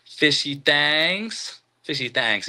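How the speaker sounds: "Thangs" drawn out with an A vowel, like "thangz"; playful drawled pronunciation. Shown as a demonstration. Host showing off a friend's channel name, playful drawled "Thangs". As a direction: stretch "Thangs" slowly, rhyming with "bangs". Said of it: "You see how I did that?".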